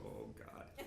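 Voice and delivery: retelling his fright at the door window, hushed whisper; whispered dread. Retold or imitated. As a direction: whisper it as the boss appears at the window. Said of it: "and I go".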